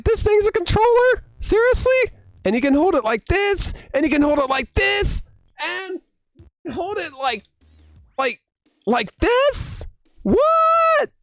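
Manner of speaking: squeaky-high incredulous questions; hammed-up astonishment. Player showing ways to hold the controller, exaggerated disbelief. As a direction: act mind-blown, pitch soaring to a final high “what”. Acts out mind blown by controller.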